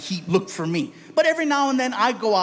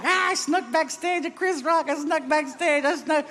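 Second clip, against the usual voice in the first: mock gleeful brag; 8 semitones higher than usual. Gloating to himself.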